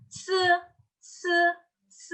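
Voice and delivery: C as "ts" with a puff of air; tongue behind the upper teeth, breathe out on C; aspirated C. Shown as a demonstration. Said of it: "ada aspirasinya".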